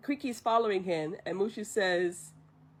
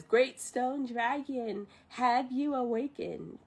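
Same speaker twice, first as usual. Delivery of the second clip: slow, stately imitation of a leader; drawn-out ceremonial summons; solemn leader; 3 semitones higher, slower than usual. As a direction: intone it grandly, word by word. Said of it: "the leader says".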